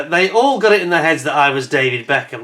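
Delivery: normal talking voice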